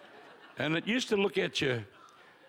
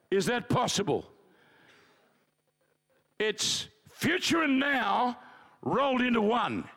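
raised, ringing preacher voice; preaching; 8 semitones higher than usual. Acted. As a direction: proclaim it from the pulpit. Preaching on faith.

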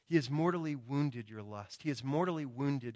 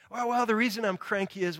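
louder, higher mock whine of a cranky excuse-maker; whiny excuse; 7 semitones higher, 5 dB louder than usual. Preacher mimics a cranky churchgoer blaming a bad day, mock whiny. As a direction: play the self-excusing grump, hammed up.

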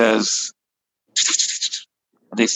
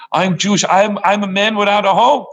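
distressed plea; 6 semitones higher than usual. Acted. Boy pours out his plight.

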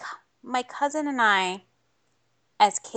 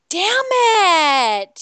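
frustrated outburst; 8 semitones higher, 5 dB louder than usual. Retold or imitated. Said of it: "you're just like".

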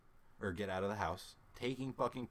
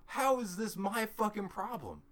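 high, slow, louder indignation; indignant protest; 10 semitones higher, 5 dB louder than usual. Retold or imitated. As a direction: protest it high, dragging the words. Retold indignation at dad.

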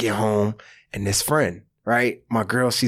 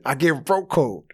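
usual speaking voice

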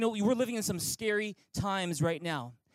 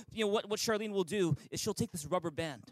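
normal talking voice

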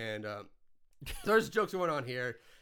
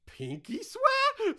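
very high, drawn-out mock plea; mock pleading squeal; 5 dB louder, much slower than usual. Hitler bargaining with the dad, hammed-up high plea. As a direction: squeak it slowly, hamming up the plea.

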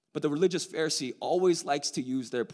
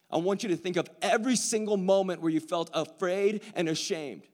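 higher, louder altar call; fervent preaching; 7 semitones higher, 6 dB louder than usual. Acted. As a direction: press it home to the room. Preaching the altar call at full force.

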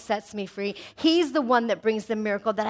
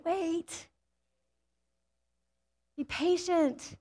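quiet and tender, almost breathed; soft hushed wait; 5 semitones higher, 8 dB quieter, much slower than usual. Acted. Preacher softly voicing God's call to wait. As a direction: hush it like God gently urging.

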